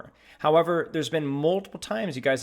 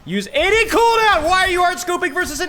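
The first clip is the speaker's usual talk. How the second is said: loud and high, exasperated; frustrated shout; 8 dB louder than usual. Real, not acted. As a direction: yell at a teammate in disbelief. Frustrated callout mid-fight.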